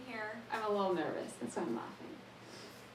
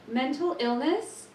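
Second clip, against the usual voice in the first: gasping surprise; 5 semitones higher, 6 dB louder, slower than usual. Acted. Mock-shocked gasp in poem.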